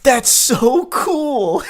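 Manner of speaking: high, loud, drawn-out mock enthusiasm; hammed-up gushing, stretched slow; exclamatory. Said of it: "love that with the exclamation point".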